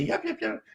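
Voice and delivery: coyote yips. Retold or imitated. Imitating coyote yips from website.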